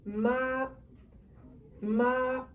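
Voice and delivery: "ma" held level and high; flat first tone. Shown as a demonstration. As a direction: sing "ma" on one high steady note. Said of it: "we said it's the flat tone".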